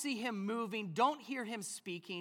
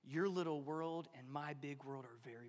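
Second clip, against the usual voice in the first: soft intimate voice; 8 semitones lower, 8 dB quieter than usual. Acted. God speaking to the heart.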